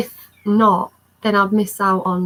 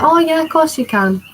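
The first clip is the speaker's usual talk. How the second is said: high cheerful agreement; 8 semitones higher, 4 dB louder than usual. Retold or imitated. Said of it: "And he was like".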